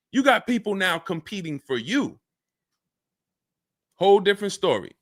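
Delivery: normal talking voice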